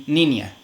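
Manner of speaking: Niña again as "ninja", n plus i; repeat Argentine nia. Shown as a demonstration. The teacher repeats the Argentine niña as "ninja". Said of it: "dicen niña".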